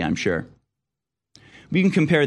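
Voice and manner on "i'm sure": low dry sarcasm; sarcastic. Real, not acted. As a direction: mutter it in disbelief.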